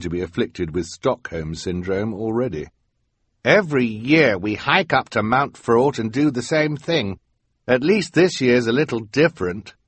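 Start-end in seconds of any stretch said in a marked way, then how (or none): 3.4-9.7 put-on higher careless kid voice; bored scout. A narrator's reading. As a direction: shrug it off. Resigned Snow Scout speaks.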